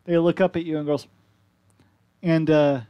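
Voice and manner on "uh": ordinary talk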